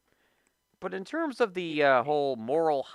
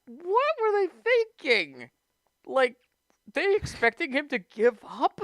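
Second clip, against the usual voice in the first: high-pitched, incredulous question; exasperated outburst; 8 semitones higher, 3 dB louder than usual. Real, not acted. Host baffled by those expecting him to give up, exasperated. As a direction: throw it out in disbelief.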